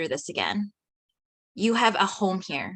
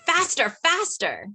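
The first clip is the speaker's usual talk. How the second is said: much higher, eager cheering; thrilled; 8 semitones higher than usual. Acted. Katie on the inner tube urges Hope to speed up, higher excited cheer. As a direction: urge the boat on with glee.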